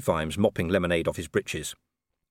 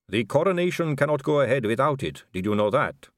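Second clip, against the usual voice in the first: put-on measured, accented vampire aristocrat; smooth vampire lady; 8 semitones higher, 4 dB louder than usual. Vampire lady giving Vimes the diplomatic line, smoothly.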